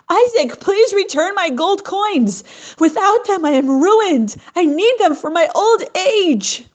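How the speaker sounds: high, loud begging through the door; desperate pleading. Acted. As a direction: plead for your life savings. Widow begging at shut door.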